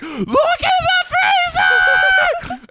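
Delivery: high, loud, drawn-out yell; frantic shout. Retold or imitated. Scared kid yelling to mama about the deer. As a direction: scream it at your mom in panic. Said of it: "I said".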